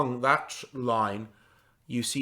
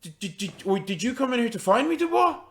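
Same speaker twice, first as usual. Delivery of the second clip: high, stuttering disbelief; astonished stammer; 10 semitones higher, 3 dB louder, faster than usual. Acted. Dazed farmer asks his friend Dubois, astonished. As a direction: stumble into the question, rising high on the name.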